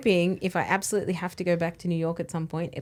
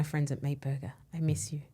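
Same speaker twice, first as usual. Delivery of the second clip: whispering; 3 semitones lower, 7 dB quieter than usual. Pretend. Mock shout-out to restaurant.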